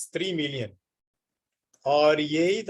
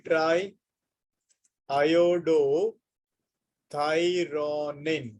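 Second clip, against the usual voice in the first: very slow iodothyronine, stretched into separate chunks; syllable by syllable; much slower than usual. Shown as a demonstration. Teacher gives students the full form of T three, syllable by syllable. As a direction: pause inside iodothyronine and draw out each syllable.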